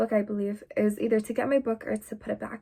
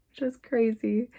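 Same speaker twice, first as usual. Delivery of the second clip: ordinary talk, nothing marked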